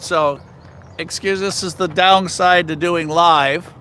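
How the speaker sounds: normal talking voice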